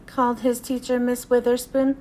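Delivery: ordinary delivery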